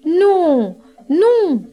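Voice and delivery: high-pitched double refusal; small galette calling out no; little galette. A narrator's reading. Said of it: "dit la galette".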